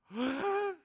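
high sharp gasp; mock collective gasp of fear; startled gasp. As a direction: gasp in sudden fright. Disciples see something at night.

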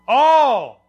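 ALL belted high and loud, then falling; emphatic key word. Real, not acted. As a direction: proclaim ALL as the answer for the congregation. Supplies the key word loudly.

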